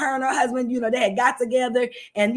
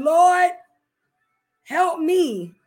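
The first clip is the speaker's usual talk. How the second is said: retold shower prayer; 5 semitones higher, 3 dB louder, much slower than usual. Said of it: "Sometimes I'm in there crying and saying".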